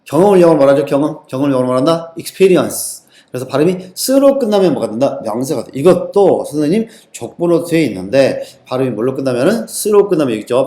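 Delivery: normal talking voice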